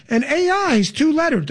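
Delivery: mocking high-pitched impersonation; Kamala Harris. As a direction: parody an earnest explainer at normal speed. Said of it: "Let me say it twice".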